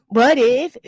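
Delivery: slow, heaving mock-breathless voice. Mocking a rival's breathless podcast talk, slow and heaving. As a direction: drag it out like you can't catch your breath. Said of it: "what are you out of breath from?".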